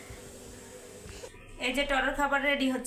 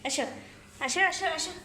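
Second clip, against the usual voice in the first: calling the cat to dinner; 6 semitones higher than usual. Real, not acted. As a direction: call her over eagerly to eat.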